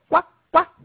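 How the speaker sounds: graznido agudo y fuerte; graznido de pato. Shown as a demonstration. Imita al pato. (juego de sonidos para niños, graznido de pato)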